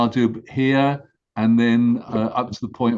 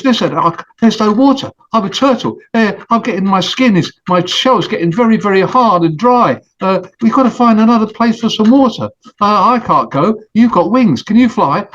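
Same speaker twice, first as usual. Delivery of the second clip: higher voiced turtle, worried about the drying pond; a thirsty turtle begging his friend for help; turtle pleading; 6 semitones higher, 7 dB louder than usual. Turtle asks the goose to fly, higher, pleading.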